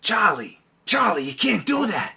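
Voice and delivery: Danny DeVito impression. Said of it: "try Danny DeVito".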